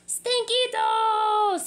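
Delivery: slow, loud high yell. Acted. Skipito yells at the smelly chimichangos. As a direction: bellow it out. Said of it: "he yelled".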